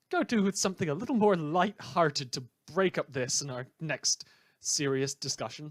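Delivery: usual speaking voice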